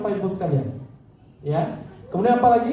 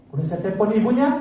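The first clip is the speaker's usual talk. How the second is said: usual speaking voice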